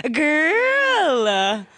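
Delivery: high, long drawn-out imitation; mock "girl". Co-host copying host's impression, high and drawn out. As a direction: copy the exaggerated, stretched "girl". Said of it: "do it with your girl caller".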